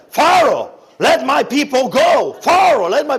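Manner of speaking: high, full-force shout to Pharaoh; pumped-up preaching. Acted. Preacher rehearsing his Passover sermon at Pharaoh, pumped-up shouting. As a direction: thunder it like a fired-up preacher. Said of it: "I was pumped in my heart".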